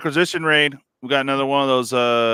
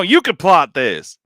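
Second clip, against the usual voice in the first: mock Southern accent; 4 semitones higher, 7 dB louder, slower than usual. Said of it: "that was pure cringe".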